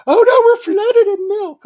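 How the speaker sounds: high, loud mock panic; overwhelmed villager. Villagers flooded with milk cry out to Bridget, high loud panic. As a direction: squeal it out, swamped in milk.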